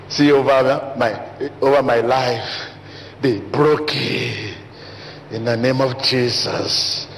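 slow drawn-out mock prayer; mock loud prayer. Preacher imitating mouth-prayer, slow and drawn. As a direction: draw it out like a showy prayer. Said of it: "you say".

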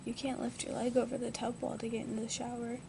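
ordinary talk

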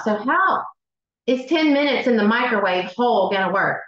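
normal talking voice

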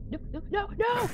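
rising panicked yell, no no no; frantic shout. Real, not acted. Gamer yelling at a failed jump. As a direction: scream it as it all goes wrong. Failing a hard game section.

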